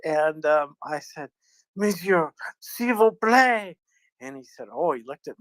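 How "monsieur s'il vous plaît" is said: drawn-out, higher, pleading please in French; polite French request. Retold or imitated. To a man cutting in line at Orly, slow polite French plea. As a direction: ask him sweetly to step back, in slow French.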